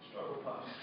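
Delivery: ordinary talk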